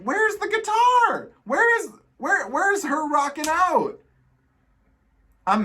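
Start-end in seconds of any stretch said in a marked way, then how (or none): 0.0-4.0 disbelief. Real, not acted. Complaining about missing guitar.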